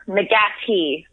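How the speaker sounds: "McGatty" with a plain t in place of th; "McGatty" as the Irish say it. Shown as a demonstration. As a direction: say "McGatty", stress on GAT, hard t. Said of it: "they don't pronounce the H".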